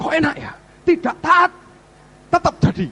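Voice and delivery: high, loud mock exclamation; mock incredulity. Preacher voices someone amazed at gain without obedience, mock incredulous. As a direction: pitch it way up as if astonished. Voices a disobedient person's reaction.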